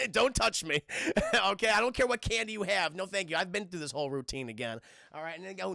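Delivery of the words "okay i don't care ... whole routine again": plain reading voice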